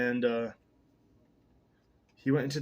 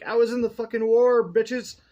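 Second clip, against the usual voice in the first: high, loud brag; mock boast shout; 9 semitones higher, 7 dB louder than usual. Menenhedet stands up and boasts to the room, a hammed-up loud brag. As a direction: swagger it out, playfully hammed-up.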